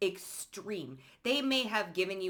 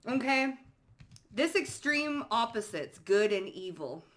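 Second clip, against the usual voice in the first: ordinary talk, nothing marked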